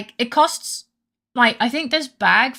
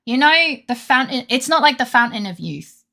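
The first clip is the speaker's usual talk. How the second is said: ranting; 5 semitones higher, 5 dB louder than usual. Real, not acted. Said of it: "I'm going on a rant about coffee".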